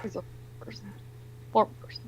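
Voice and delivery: bitter whisper; whispered verdict. Real, not acted. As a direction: breathe it out under her breath.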